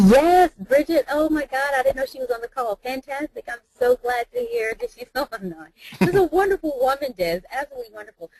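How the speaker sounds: loud and high, thrilled to hear her friend is there; bursting with real joy at the news; delighted surprise. Real, not acted. Surprised friend joined the call.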